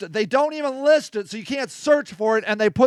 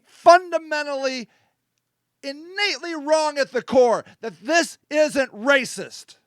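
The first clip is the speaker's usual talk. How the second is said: indignant challenge. Real, not acted. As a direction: weigh every phrase, daring anyone to disagree. Host's indignant challenge to the audience.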